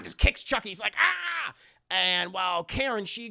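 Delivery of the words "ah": high cry of someone kicked; acted yelp. Storyteller as kicked doll, high acted yelp. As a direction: yelp as if booted.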